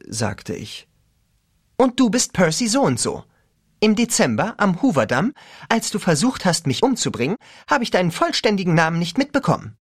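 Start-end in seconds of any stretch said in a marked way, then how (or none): 1.8-9.8 lightly voiced teen girl; a higher girl's voice. A narrator's reading.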